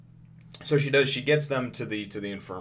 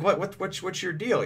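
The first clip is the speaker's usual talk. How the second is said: high, sharp "what's your deal"; startled question; 9 semitones higher than usual. Retold or imitated. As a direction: toss it up in disbelief. Friends question Luffy.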